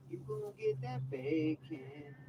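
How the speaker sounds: pet talk. Acted. Teasing the cat with bacon.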